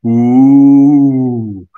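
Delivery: drawn-out spooky ghost ooh; a long haunting ghostly moan; ghost impression. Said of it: "that was my ghost impression".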